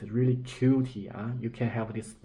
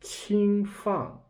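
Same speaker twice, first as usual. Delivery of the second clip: 轻放 said up high; high example; 9 semitones higher than usual. Shown as a demonstration. Teacher contrasting 轻放 with 轻轻地放, high pitch. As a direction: lift 轻放 up high and light. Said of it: "We have also here 轻轻地放".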